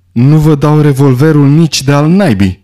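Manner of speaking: loud, defiant outburst; shout. A narrator's reading. Lombard refusing to hand over his revolver, defiant shout. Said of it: "strigă Lombard".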